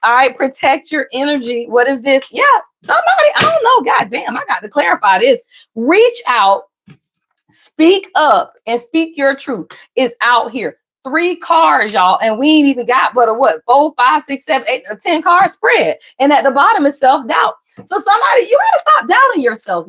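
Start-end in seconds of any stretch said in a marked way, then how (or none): 2.3-3.9 sudden high shout at the cards; a startled yell of excitement; excited shout. Real, not acted. Sudden burst reacting to cards. 17.8-19.9 high, crying-out plea; an impassioned cry to stop doubting; urgent outcry. Real, not acted. Urging viewers about self-doubt.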